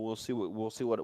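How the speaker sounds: ordinary talk, nothing marked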